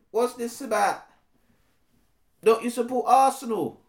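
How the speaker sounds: defensive roadman brushing off a complaint. Acted. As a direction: shrug it off with street swagger. Street-slang character voice.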